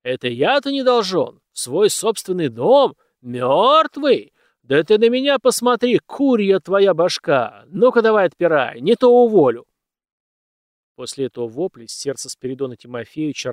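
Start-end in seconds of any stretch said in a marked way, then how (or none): 0.0-9.6 loud, high enraged shouting; louder and louder to the final threat; furious roar. Acted. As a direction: bellow him down, threaten to fire him. Master roars at servant.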